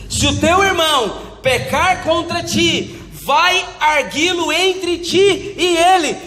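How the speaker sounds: high, slow scripture declamation building to exhortation; forceful preaching. Real, not acted. Preacher urging people to settle offences privately, forceful.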